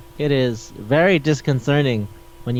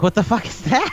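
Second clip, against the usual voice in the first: high-pitched incredulous question; playful what-on-earth reaction; mock bafflement; 6 semitones higher than usual. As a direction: imitate someone utterly thrown. Acted baffled reaction to disease.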